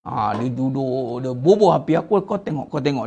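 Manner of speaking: sly devil murmuring; devil whispering. Retold or imitated. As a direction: murmur it low like Satan.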